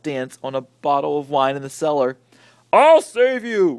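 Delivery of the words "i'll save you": slow, loud heroic roar. Acted. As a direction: bellow it like a brave old uncle. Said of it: "roared Uncle Theodore".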